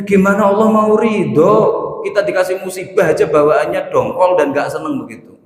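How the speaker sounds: ordinary talk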